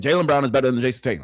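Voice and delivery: rattled off fast and loud; fast repeat. Real, not acted. Streamer to chat, fast emphatic repeat. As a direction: fire it off quickly. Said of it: "Let me say it again".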